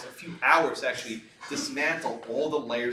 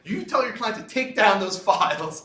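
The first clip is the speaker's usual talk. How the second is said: imitating the governor; 9 semitones higher, 7 dB louder than usual. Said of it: "He's like".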